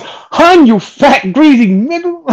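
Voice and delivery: high, taunting killer voice; menacing quote. Retold or imitated. Friend retells a movie villain's taunt, high and sneering. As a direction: sneer it before pulling the trigger. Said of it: "he goes".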